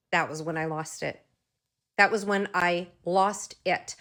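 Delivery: normal talking voice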